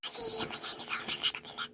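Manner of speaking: breathy whisper; barely a sound, obedient; whispered promise to mother. Acted. Child agrees to stay silent.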